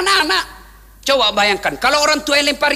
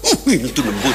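amused mock voice; 12 semitones lower than usual. Mock reaction, laughing.

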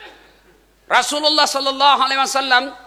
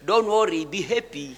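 softer, lower, laid-back quote; carefree reassurance; 5 semitones lower, 4 dB quieter than usual. Retold or imitated. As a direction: sound playfully unbothered, dropping away softly. Prophet's unbothered reply, retold jokingly.

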